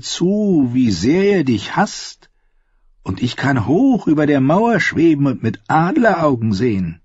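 a skinchanger boasting to Jon of the eagle; Varamir the skinchanger. Acted.

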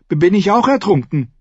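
put-on frightened boy stammering a question; nervous stammer. A narrator's reading. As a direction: stumble over the first word in fear. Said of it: "fragte er Joyen nervös".

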